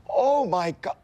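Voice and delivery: high-pitched startled cry of disgust; retold scream. Germaphobe mourner shrieking at poop on his hand, high startled cry. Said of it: "and I screamed".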